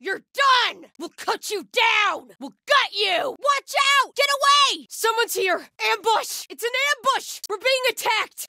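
high, shouted combat callouts; alarmed battle shout. Acted. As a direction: yell threats and warnings at the attackers. Enemy combat alarm barks.

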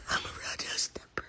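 breathy hushed whisper of the lyric. Real, not acted. Fan repeating her idol's lyric, whispered. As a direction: whisper it to yourself.

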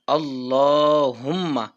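আল্লাহুম্মা chanted slowly, the long vowel stretched and held; slow drawn-out recitation. Shown as a demonstration. Teacher chanting the dua for students, slow and drawn. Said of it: "এখানে আমরা পড়ব".

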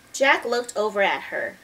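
ordinary delivery, nothing marked

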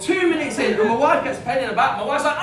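usual speaking voice

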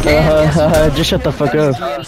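ordinary talk, nothing marked

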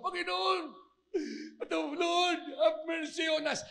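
high, imitated cry for mercy; acted high-pitched plea; pleading shout. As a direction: cry out to the Lord like a desperate leper. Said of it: "itong mga may ketong sumisigaw".

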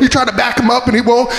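ordinary talk

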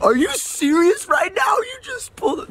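very high, hammed-up outraged yell; mock road rage. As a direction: squawk at a driver who cut you off, playfully overdone. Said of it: "I get so mad sometimes".

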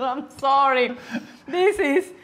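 ordinary delivery

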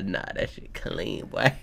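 hushed, slow breathy awe; awed whisper. Real, not acted. Admiring the monkey versus dragon panel, awed whisper.

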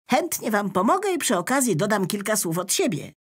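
quick, chirpy pen voice; the pen rolls up offering help, eager. Acted. Pen character.

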